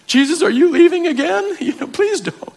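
very high, whiny, half-laughing plea; mock panic, begging him not to go; anxious pleading. Preacher as anxious believer begging Jesus to stay, squeaky plea. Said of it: "I'm like".

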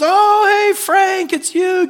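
put-on a jolly gatekeeper greeting an old friend; hammed-up Peter. Saint Peter greeting Frank at the gate, gushing and high.

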